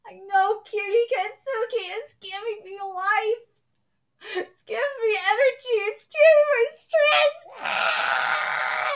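building excited scream. Real, not acted. Fan gift overwhelms her, rising to a scream. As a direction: build from gushing to a shriek.

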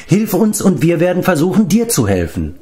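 high plea, settling down at the end; imploring. A narrator's reading. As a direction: beseech him, opening high and urgent. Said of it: "beschwor ihn Taran". (beschwörend, flehend)

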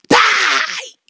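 loud, high shouted death command; the intruder shouting at her in bed; intruder's shout. Retold or imitated. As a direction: spit out the order to kill. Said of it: "He said".

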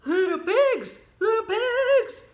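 high, loud wolf voice; wolf's polite call. Acted. Wolf calling at the brick house. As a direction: call sweetly through the door. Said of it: "knocked politely on the door and said".

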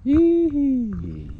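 high, loud yee-hee of pure fun; gleeful whoop. Real, not acted. On the park swing, gleeful high yee-hee.

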